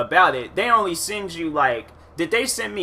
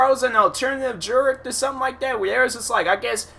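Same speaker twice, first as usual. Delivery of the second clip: usual speaking voice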